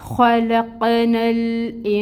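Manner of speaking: slow melodic tajweed recitation; recited demo. Shown as a demonstration. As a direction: chant it slowly as in recitation. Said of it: "تو یہ بنے گا". (آہستہ سریلی تلاوت کا نمونہ)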